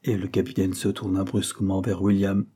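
ordinary delivery

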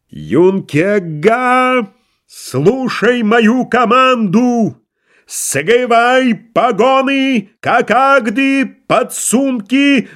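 high, slow, howled command with burred r; картавый командирский вой. Acted. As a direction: bellow orders, swallowing every r. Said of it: "он взвыл команду необычным, неслыханным картавым голосом". (картавый протяжный крик команды)

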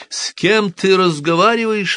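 the wife cries out in fright; cry out. A narrator's reading. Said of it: "вскричала госпожа Долей".

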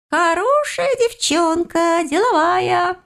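high, slow, drawn-out approval; pleased little creature. A narrator's reading. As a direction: savour it in a piping voice. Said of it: "довольно отозвался практичный".

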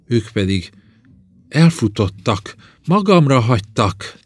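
building to a high, loud cry; rising, almost screaming. A narrator's reading. Abandoned princess accusing those who ran, nearly screaming. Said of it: "Egyre élesebb hangon beszélt, végül szinte már sikított".

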